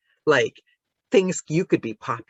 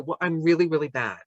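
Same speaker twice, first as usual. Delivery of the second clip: ordinary delivery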